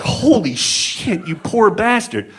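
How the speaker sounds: high, drawn-out shocked sympathy; stunned shock. Retold or imitated. Comedian reacting to the clerk's story, stunned disbelief. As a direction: draw it out, stunned and pitying.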